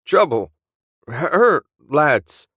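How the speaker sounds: put-on high, loud broken shouts in Willie's accent; Willie's garbled warning. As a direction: bark it out in Willie's accent like a failing radio. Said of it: "No one else says lads in an accent like that".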